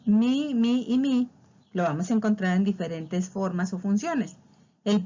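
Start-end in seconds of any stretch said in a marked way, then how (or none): 0.0-1.3 "mi, mi y mi" each said slowly and high; slow listing. Shown as a demonstration. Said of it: "monosílabos". ("mi, mi y mi" despacio)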